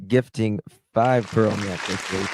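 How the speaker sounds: ordinary talk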